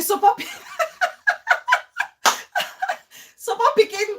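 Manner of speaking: giggling through the words, pitch high; laughing hard while trying to talk; host laughing at her co-host, breaking into giggles. Real, not acted.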